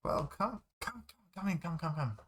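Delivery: high-pitched, fussy invitation indoors; welcoming neighbor. Acted. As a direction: usher a guest in with quick repeated high words. Neighbor ushers visitor in.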